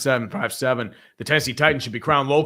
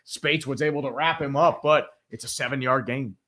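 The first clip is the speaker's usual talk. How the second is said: sportscaster calling the play. Acted. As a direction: call it like a TV announcer. Live game play-by-play.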